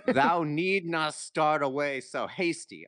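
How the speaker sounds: put-on loud, high, stumbling read of old Scots verse; sounding out Scottish dialect poetry, loud and amused; reading Burns aloud. Friend reads Burns's poem aloud on a dare, loud and halting. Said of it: "try to read this part of his poem".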